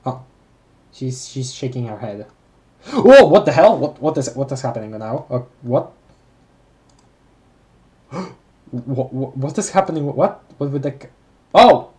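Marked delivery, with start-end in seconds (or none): 2.9-3.8 loud shocked oh; sudden shocked cry; startled outburst. Real, not acted. Startled by shaking head. 11.5-11.9 loud sudden oh; shocked yelp; startled oh. Real, not acted. Machine exploded.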